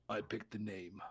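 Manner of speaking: very low, very quiet voice; understated heavyweight, deep and hushed; jacked tough woman. Acted. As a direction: drop it low and soft, quietly imposing. Jacked woman steps forward and speaks.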